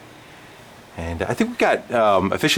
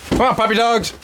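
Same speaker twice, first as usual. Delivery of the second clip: calling the dogs; 14 semitones higher, 3 dB louder than usual. Real, not acted.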